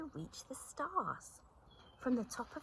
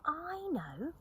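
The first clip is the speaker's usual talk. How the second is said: bright eager call; 8 semitones higher than usual. A narrator's reading. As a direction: call out the idea. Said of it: "called Lippity Rabbit".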